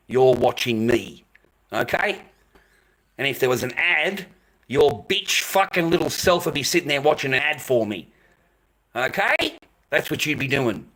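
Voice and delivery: loud, heated telling-off; real irritation, hammering it home loudly; angry berating. Real, not acted. Berating a viewer in chat.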